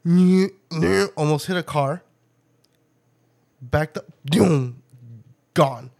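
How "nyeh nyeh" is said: louder nasal nyeh sounds for a tight turn; playful imitated engine whine; mimicked tire squeal. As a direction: make the truck's turning noise. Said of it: "He went".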